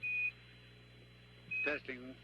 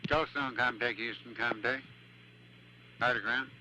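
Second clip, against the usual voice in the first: tinny radio voice check; radio chatter; 6 semitones higher, 5 dB quieter than usual. Real, not acted. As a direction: call through the comm loop. Ground station radio network check.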